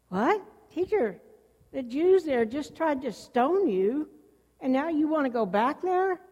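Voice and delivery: taken aback and irritated; irritated disciples. Acted. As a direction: question him in rising, irritated disbelief. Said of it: "the disciples may have been a little irritated".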